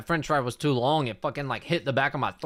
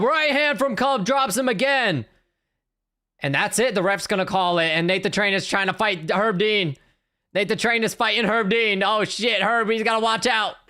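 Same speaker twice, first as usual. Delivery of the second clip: urgent, high-pitched fight call; live play-by-play; 6 semitones higher than usual. Real, not acted. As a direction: call the knockdown as it happens, voice raised in pitch. Calls the knockdown live.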